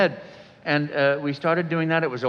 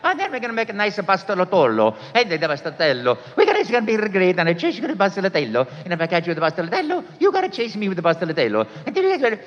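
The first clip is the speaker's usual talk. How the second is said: much higher, fast, laughing Mario rambling through pasta dishes; 5 semitones higher, faster than usual. Acted. Rambling on as Mario for the camera, high and fast. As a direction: giggle through the made-up dishes at speed. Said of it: "making up Italian".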